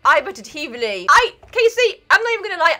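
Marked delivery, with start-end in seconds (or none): 1.1-2.8 high, loud indignant call-out. Real, not acted. Betrayed by Casey, a loud high call-out.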